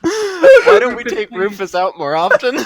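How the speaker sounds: high, loud, through laughter; amused, laughing. Real, not acted. Player delighted by Rufus's joke, laughing as they speak. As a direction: call it out while cracking up.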